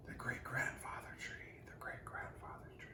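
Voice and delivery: unvoiced whisper fading away. A narrator's reading. News whispering through the forest, fading. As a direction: let it drift off through the forest. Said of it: "The news went whispering".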